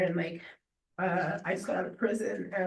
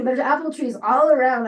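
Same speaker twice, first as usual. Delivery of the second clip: exasperated protest; 5 semitones higher, 11 dB louder than usual. Retold or imitated. Arguing with friend over apples.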